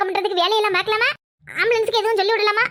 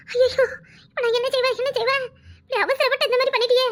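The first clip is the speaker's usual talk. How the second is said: crying lament; 4 semitones higher than usual. Acted. Sister finds sister poisoned.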